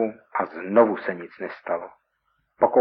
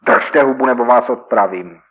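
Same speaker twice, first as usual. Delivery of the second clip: louder menacing order; threat; 3 semitones higher, 7 dB louder than usual. Acted. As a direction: shut him up with a threat. Robber threatens victim. (výhrůžný rozkaz, hlasitěji)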